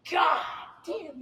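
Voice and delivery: high, loud mock yell; retold shouted curse. Pilot banged his head on helicopter, retold shouted curse. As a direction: re-enact it as a strained high shout. Acts own reaction to being banged.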